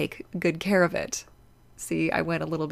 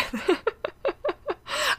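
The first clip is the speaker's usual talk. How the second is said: embarrassed laugh; 12 semitones higher, 3 dB quieter than usual. Real, not acted. Laughs at her own sniffling.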